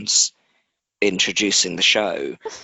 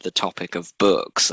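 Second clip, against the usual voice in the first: usual speaking voice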